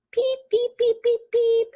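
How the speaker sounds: tiny high-pitched peeps; string of short, high peeps; chick peeping. A narrator's reading. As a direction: peep like a baby chick. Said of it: "and her chick went".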